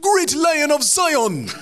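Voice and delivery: booming slow Jamaican exclamation, high; Jamaican accent. Acted. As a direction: cry it out like Hermes in a thick Jamaican accent. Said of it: "writing in the, the Jamaican phrases".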